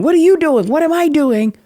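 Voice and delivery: high, loud, frantic self-questioning; arguing with herself. Retold or imitated. Grieving mother reenacting arguing with herself in the bathroom, frantic and loud. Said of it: "I literally am arguing with myself".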